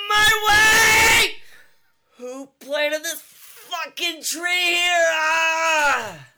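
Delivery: very high, loud furious shrieks; screaming in labor. Acted. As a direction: yell through painful contractions.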